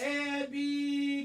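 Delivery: loud, high, stretched cue; drawn-out announcer call. Real, not acted. Host starting the players on chapter two, loud drawn-out call.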